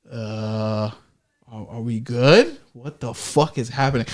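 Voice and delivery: quiet nervous muttering under breath; hushed, uneasy. Retold or imitated. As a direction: say it low, spooked and unsure. Hears a scream while high.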